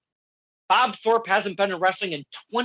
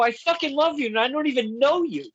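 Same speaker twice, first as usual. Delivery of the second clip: laughing; 7 semitones higher than usual. Retold or imitated. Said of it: "I couldn't stop laughing".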